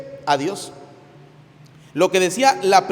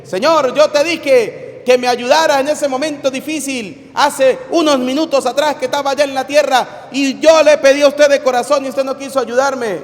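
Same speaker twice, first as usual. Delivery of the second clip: raised, insistent protest to the Lord; indignant complaint; 6 semitones higher, 4 dB louder than usual. Acted. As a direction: push the grievance up and out. Dead climber complains to the Lord.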